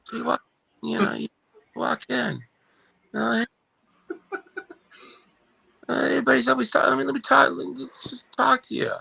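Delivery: put-on high, rambling old politician voice; Joe Biden impression. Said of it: "working on my Joe Biden".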